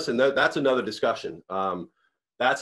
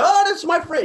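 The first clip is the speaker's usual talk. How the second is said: imitating a friend's delighted high exclamation, louder; mock-excited outburst, pitched way up; 6 dB louder than usual. Friend introducing him to others, high and excited. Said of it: "He still brings it up".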